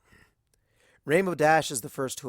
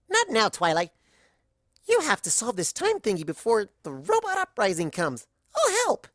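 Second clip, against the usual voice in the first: high and louder, bursting to help; eager Pinky; 8 semitones higher, 4 dB louder than usual. Acted.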